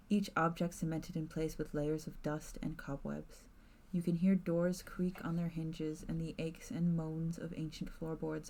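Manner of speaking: measured narrator voice; story narration. A narrator's reading. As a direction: set the scene like a storyteller. GM narrates scene.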